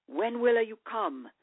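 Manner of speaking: slow, Italian-tinged English question; a lightly British-Italian accent, asking slowly; quirky Britalian accent. A narrator's reading. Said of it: "you asked in your quirky Britalian accent".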